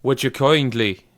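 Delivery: tentative, over-deliberate Bioshock accent impression; attempted Bioshock accent. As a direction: draw it out in a put-on accent. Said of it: "nah, it's too deep".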